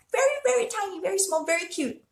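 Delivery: high, soft, cooing little voice; cutesy tiny voice. Real, not acted. Host describes a tiny Chihuahua she saw, cutesy high voice. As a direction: shrink the voice, high and small.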